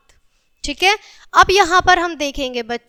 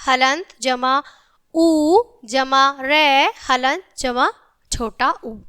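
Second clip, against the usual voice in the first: slow, syllable by syllable; slow spelling out; slower than usual. Shown as a demonstration. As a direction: spell it out slowly for children. Teacher spells out letter by letter. (धीरे-धीरे अक्षर जोड़कर बोलना)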